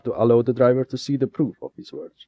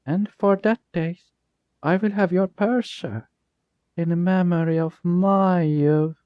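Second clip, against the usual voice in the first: cold threat; 9 semitones higher than usual. Acted. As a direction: let the sweetness turn cold. Jasper turns threatening with dagger.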